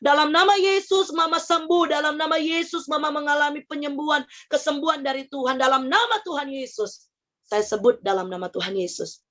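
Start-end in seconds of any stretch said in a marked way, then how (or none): 0.0-7.1 re-enacted healing prayer, pitched up at the start; insistent, higher-pitched prayer proclamation; prayer declaration. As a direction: declare it over a sick mother in prayer. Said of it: "saya bilang begini".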